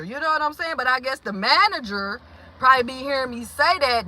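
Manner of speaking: ordinary talk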